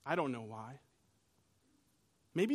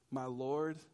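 hushed and lower, fading away; soft, reverent whisper of wonder; awed confession; 5 semitones lower, 7 dB quieter than usual. Acted. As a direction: say it in quiet awe. Thomas's awed response to Jesus.

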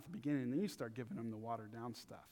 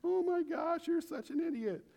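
much higher, louder taunting relative; mocking relative; 11 semitones higher, 6 dB louder than usual. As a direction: rub it in like an old relative at a family dinner. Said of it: "He'd be, like, eighty years old".

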